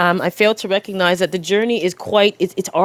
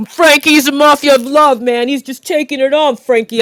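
raised, hammy mobster patter; mafia movie voice; 4 semitones higher than usual. Acted. Hyping Frankie as a mafia of love, mobster voice. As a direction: play a movie mafioso. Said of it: "feels like I'm in a, a mafia movie".

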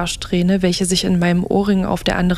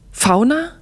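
high, rising shocked cry; horrified cry; 6 semitones higher than usual. A narrator's reading. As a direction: gasp out the name in horror. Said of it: "schrien Flo und ich beide gleichzeitig entsetzt auf". (entsetzt, hoch aufschreiend)

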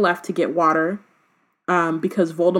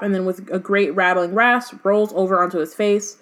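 normal talking voice

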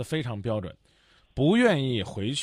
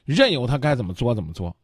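ordinary delivery

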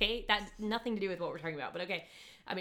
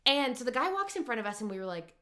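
normal talking voice

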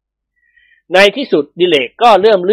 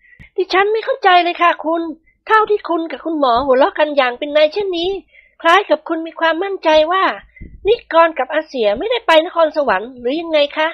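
much higher feminine character voice; puzzled, suspicious wife; 12 semitones higher than usual. A narrator's reading. Praphai asks why the men laughed, suspicious high questioning. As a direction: question them in a high, polite voice.

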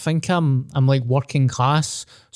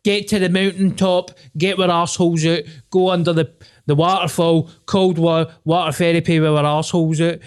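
mock grand speech; 5 semitones higher than usual. Mock inspirational rant.

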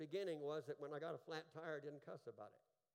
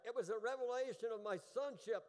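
higher, louder preached repeat, falling at the end; emphatic repeat; 8 semitones higher, 8 dB louder than usual. Shown as a demonstration. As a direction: drive it home harder from the pulpit. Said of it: "Are you hearing that?".

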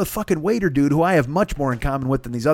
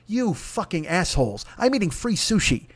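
usual speaking voice

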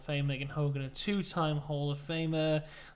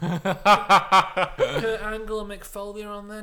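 laughing loud; 4 semitones higher, 11 dB louder, slower than usual. Retold or imitated. Fiend voice, laughing.

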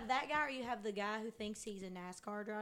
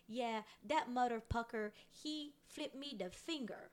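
imitating mom's funny Filipino accent; playful Filipino-accented retelling, pitch lifting; 3 semitones higher than usual. Daughter imitating mom's road-rage story, Filipino accent. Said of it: "she has this really funny accent".

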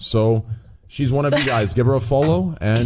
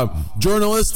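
lightly imitated journalist, pitched higher; Richard Lewis; 6 semitones higher than usual. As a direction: do a quick mock of his usual voice. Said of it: "he goes from Richard Lewis".

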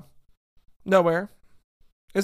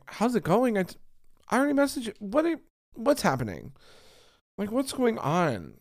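exasperated disbelief; 8 semitones higher than usual. Retold or imitated. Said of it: "And I'm like".

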